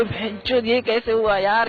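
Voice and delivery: happy surprise. Shown as a demonstration. Showing the interviewer a happy curse, pleasantly amazed. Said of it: "खुश झाल्यावरती कुठली शिवी देतो".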